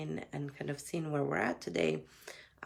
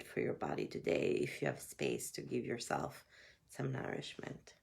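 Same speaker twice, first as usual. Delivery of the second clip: soft, gentle meditation guide; guided meditation; 3 dB quieter than usual. Acted. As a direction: ease them inward, gently. Opens with a guided check-in.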